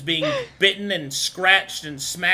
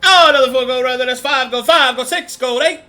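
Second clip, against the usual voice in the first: louder, higher auction calling; auctioneer; 5 semitones higher, 8 dB louder than usual. Acted. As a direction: rattle off the bids like an auctioneer. GM voices auctioneer calling bids.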